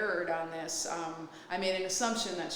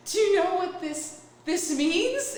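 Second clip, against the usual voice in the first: higher, mock teary-eyed question; hammed-up emotional reveal; tearful, retold; 10 semitones higher, 6 dB louder than usual. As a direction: ask it as if welling up. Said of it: "big tears welling up in my eyes".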